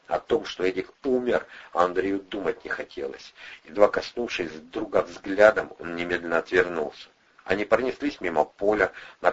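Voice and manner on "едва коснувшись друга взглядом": plain reading voice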